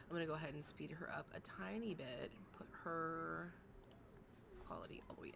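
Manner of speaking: soft unvoiced whisper. Real, not acted. Tweaking the stream settings under her breath, whispering. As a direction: keep it hushed, breath only.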